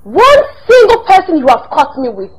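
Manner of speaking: loud angry listing of his affairs; furious shouted tirade. Acted. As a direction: let him have it, name by name. Wife listing husband's affairs.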